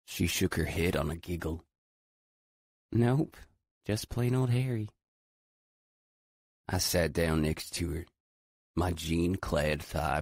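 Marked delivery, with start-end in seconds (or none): 2.9-4.9 put-on higher, giggly teen girl; bright young Southern girl teasing; lightly voiced girl. A narrator's reading. To Adam about her dog, a higher playful girl voice.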